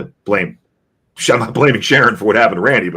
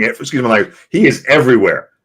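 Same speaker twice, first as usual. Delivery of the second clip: normal talking voice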